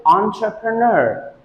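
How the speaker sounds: ENTREPRENEUR said on-truh-pruh-NUR; last-syllable stress. Shown as a demonstration. Teacher shows where stress goes, last-syllable stress. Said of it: "Stress goes on the last syllable".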